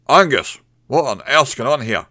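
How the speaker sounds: loud, impatient demand; impatient and gruff. A narrator's reading. Hotel owner demanding answers from barman, loud impatient gruff. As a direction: bark it at him like an angry boss. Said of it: "in an impatient and gruff voice".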